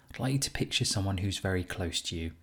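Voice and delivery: normal talking voice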